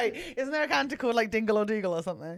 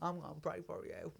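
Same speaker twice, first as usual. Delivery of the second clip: soft, quick Texan twang; Texan accent; 4 semitones lower, 15 dB quieter, faster than usual. Pretend. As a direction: murmur it in mock Texan.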